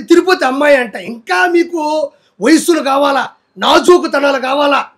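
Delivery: usual speaking voice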